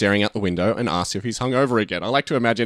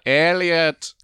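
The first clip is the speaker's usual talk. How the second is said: higher, drawn-out alien call; 6 semitones higher than usual. Acted. E T calls through the windows, drawn-out alien voice. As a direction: stretch the name out as an alien. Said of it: "as E T calls out".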